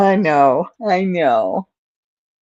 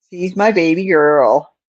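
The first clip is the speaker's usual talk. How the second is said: ordinary talk, nothing marked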